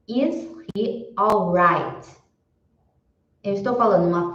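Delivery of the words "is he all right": slow, careful English question; deliberate teacher read; slow model question. Shown as a demonstration. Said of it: "se eu falar".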